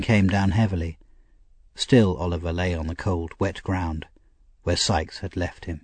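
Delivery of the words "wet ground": ordinary delivery, nothing marked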